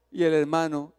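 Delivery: normal talking voice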